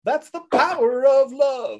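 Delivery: high, drawn-out mock proclamation; playful showy crowing; hammed-up triumph. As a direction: belt it out slowly as a joke. Player hams up a triumphant punchline.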